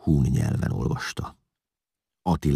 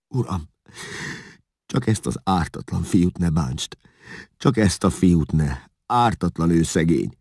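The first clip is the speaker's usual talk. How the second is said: pleading, raised voice, understated tears; tearful plea; 5 semitones higher, 4 dB louder than usual. A narrator's reading. Father begs Attila to spare his innocent son, tearfully pleading. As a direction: beg for mercy, lightly tearful. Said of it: "S a könnyei végigcsordultak az arcán".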